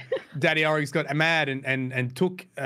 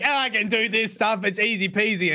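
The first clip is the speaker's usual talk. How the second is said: higher-pitched, breezy mock boast; cocky son; 9 semitones higher than usual. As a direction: imitate an overconfident son shrugging off a job. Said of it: "And he's like".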